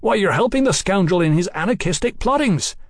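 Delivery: high, heated reproach; indignant accusation. Acted. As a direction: accuse him in outrage. Mallory accuses the king.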